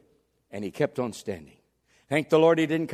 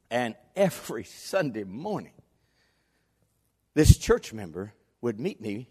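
ordinary delivery

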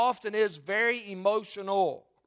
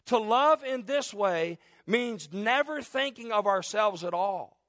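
ordinary delivery

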